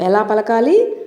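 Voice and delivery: ordinary talk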